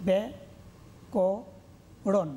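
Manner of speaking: ব্যাকরণ very slowly as bya, ko, ron; syllable by syllable. Shown as a demonstration. Teacher showing how to divide syllables, slowly separated. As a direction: break ব্যাকরণ into three syllables with long pauses. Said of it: "কীভাবে উচ্চারণ করব?".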